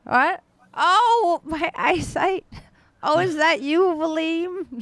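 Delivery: high, loud, exclaiming figure with poor eyesight; doddery character. Acted. Character with bad eyesight greeting Valim, high-pitched exclaiming voice. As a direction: play them high and loud, straining to recognise someone.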